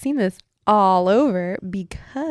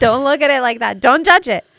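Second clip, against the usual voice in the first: playful loud protest; 5 semitones higher, 10 dB louder than usual. Real, not acted. Mock-offended plea to friend.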